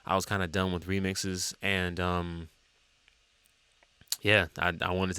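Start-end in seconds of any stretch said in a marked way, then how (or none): none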